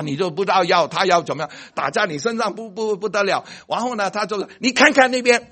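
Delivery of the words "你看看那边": high, loud look over there; sharp cheery distraction; distracting doctor. Doctor tricking the patient to look away, loudly.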